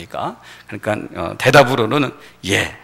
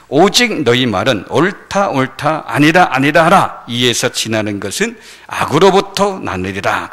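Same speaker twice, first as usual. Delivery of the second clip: scripture recitation; 5 dB louder than usual. Acted.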